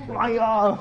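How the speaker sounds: high, loud dismayed aiya; a cry of comic dismay; dismayed exclamation. Retold or imitated. Dismay as the Buddha arrives.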